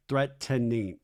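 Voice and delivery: threatening in three separate syllables, threat-ten-ning; Michelle's THREATENING. Retold or imitated. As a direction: imitate "threatening" with the extra syllable sounded. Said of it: "makes an extra syllable in threatening".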